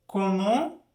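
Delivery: usual speaking voice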